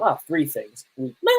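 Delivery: usual speaking voice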